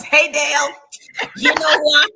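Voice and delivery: loud, high-pitched mock call; playful calling out. Acts out calling to Dale.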